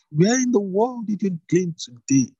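lively mother-in-law asking Ruth; excited Naomi. A narrator's reading. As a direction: ask with delighted amazement. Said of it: "Naomi exclaimed".

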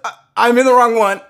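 loud, high, rushed acted panic; retold flustered outburst; panicked blurt. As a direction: stammer and blurt it out loudly. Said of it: "I panicked. And I said".

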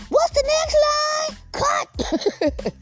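very high slow silly actor voice; mock actor voice. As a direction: play a lost actor asking for lines. Mocks a rehearsing actor.

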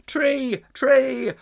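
high, loud chorus; crowd of animals. A narrator's reading. A dozen animals voting for tree. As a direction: call it out as many beasts.

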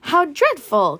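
high, loud shocked gasp of words; dismayed exclamation. Acted. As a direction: react in horror to the news. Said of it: "chorused the hostesses".

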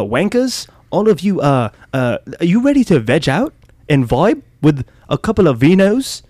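hammed-up posh British host with a put-on accent; mock British accent. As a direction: play a cheeky British podcast host, bruv.

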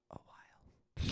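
slow whispered answer; Edward. Acted. Friend voices the film boyfriend answering, slow whisper. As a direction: whisper it, drawn out. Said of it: "I can do a good Edward impression".

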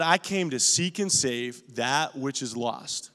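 slow, soft and solemn. Acted. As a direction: read it reverently. Quoting Jesus solemnly.

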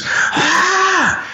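high-pitched mock scream of pain; acted high shriek; imitated child's scream. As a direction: scream like a kid who hit his head. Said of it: "and he screams".

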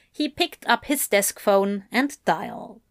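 plain reading voice

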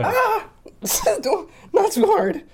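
high squealing laugh. Real, not acted. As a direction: shriek and laugh, pleading. Playful squeal while being hit.

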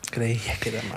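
soft unvoiced whisper; hushed echoed aside. Real, not acted. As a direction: whisper it back in agreement. Echoing the aside.

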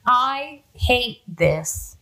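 very slow, stretched-out words; drawn-out loathing. Retold or imitated. Recapping Betty's reaction for co-hosts, drawn-out loathing. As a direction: drag out every word in mock loathing.

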